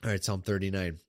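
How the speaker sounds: ordinary talk, nothing marked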